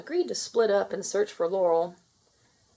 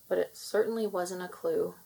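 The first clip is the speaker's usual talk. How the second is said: ordinary delivery, nothing marked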